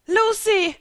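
loud, very high scream of a name; panicked scream as her friend slides down. Acted. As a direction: shriek it in full panic. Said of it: "schrie Elsa nun in Panik".

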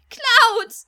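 very loud, high anguished scream. Acted. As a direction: scream his name in anguish. Cloud dissolving, Tifa in tears.

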